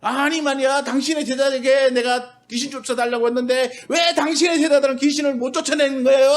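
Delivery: a father angrily challenging Jesus; demanding shout. Retold or imitated. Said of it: "막 와서 막 소리치는 거야".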